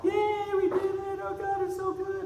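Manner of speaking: very high squealing cheer; hammed-up high-pitched celebration; mock cheering. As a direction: cheer like thrilled churchgoers. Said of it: "the rest of the church was all".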